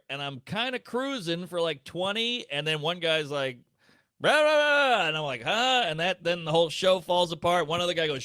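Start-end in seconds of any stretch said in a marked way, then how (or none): 4.2-5.1 put-on much higher, much louder drunken rah rah; a mock heckler bellowing from the crowd; heckler yelling. 5.4-5.8 much higher, louder baffled huh; a comic thrown off mid-set; confused huh. Retold or imitated. Comic reacting to heckler confused.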